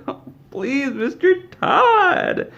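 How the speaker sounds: high, drawn-out begging whine; pleading employee. Acted. Pete begs Todd after losing his bonus, pleading. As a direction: beg the boss in a squeaky, stretched-out plea.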